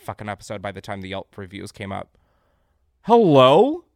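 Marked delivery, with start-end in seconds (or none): 3.0-3.8 sharp, high, loud hello; loud outraged hello; incredulous loud hello. Real, not acted. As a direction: call it out in disbelief. Outraged call-out.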